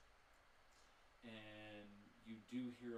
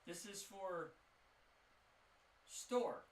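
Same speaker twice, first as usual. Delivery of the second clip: high, loud shopkeeper voice; eager shopkeeper; 6 semitones higher, 12 dB louder than usual. Acted. Shopkeeper reads the flyer from the warlock, high and eager. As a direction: keep them talking, glad for company.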